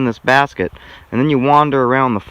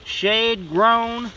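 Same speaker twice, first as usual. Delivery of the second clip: high, slow commercial voice; mock ad pitch; 9 semitones higher, much slower than usual. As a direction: sell it like a cheesy TV ad. Mock product pitch after joke.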